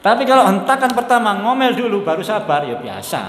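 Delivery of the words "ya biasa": laughed-through little punchline; laughing. Real, not acted. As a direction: chuckle it out.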